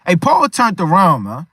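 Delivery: normal talking voice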